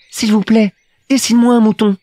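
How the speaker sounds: put-on high, serious little-boy voice; earnest Petit Prince. A narrator's reading. Little prince asks the pilot for a drawing, high and earnest. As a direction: plead politely in a high child's voice. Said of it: "tout doucement, comme une chose très sérieuse".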